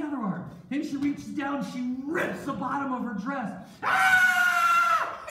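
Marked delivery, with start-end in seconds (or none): 3.8-5.3 very high, long shriek; mock scream. Wife rips her dress, high staged scream. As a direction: shriek loudly and hold the note. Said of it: "And then she screamed".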